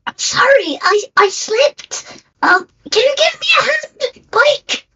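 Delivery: high, pleading cry for help; panicked high voice. Acted. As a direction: squeak out a panicked plea.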